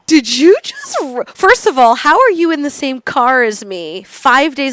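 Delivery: slow, high, incredulous questioning; acted amazement. Comic amazed at meeting a fan in her train car, incredulous.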